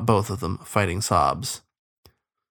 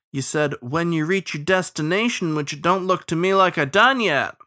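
raised-pitch riverman retort; shouted back; 7 semitones higher than usual. A narrator's reading. Captain disputing the starling's order, mildly shouted back. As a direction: argue back, voice lifted. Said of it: "Fitzalmo shouted back".

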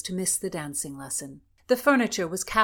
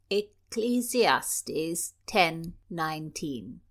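slow, grave citing of the verse; name the verse deliberately; slow citation; 2 semitones lower, much slower than usual. Acted. Chaplain cites verse.

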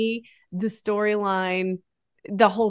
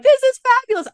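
high, delighted gushing, much higher; 6 dB louder than usual. Retold or imitated. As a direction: squeal it like a thrilled fan. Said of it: "I'm like".

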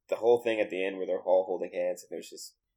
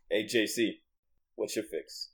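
manly voice; 4 dB quieter, slower than usual. Acted. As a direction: say it plainly like a tough guy. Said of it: "I'm gonna say it like a man".

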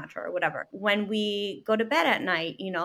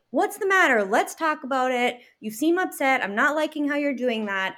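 raised pitch, mock confrontational questioning; poke-the-bear prodding; 5 semitones higher, 4 dB louder than usual. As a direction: act out pestering a husband to talk. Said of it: "I'm like a poke the bear".

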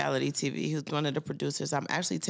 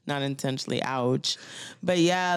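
ordinary delivery

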